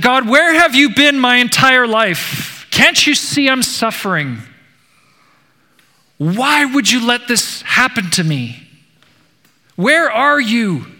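despairing man yelling at heaven; angry outburst. Retold or imitated. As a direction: hurl the questions up in pain and rage. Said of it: "he exploded in anger".

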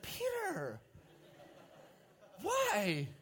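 high, drawn-out plea on the name; mock exasperation. Preacher teasing Peter for jumping overboard, mock exasperated. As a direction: playfully wail it at a hopeless friend. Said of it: "You're just like".

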